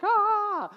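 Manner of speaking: put-on high, loud child's shriek; little girl's scream. Pastor retelling a girl scared by a fake spider, shrieking. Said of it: "she screamed".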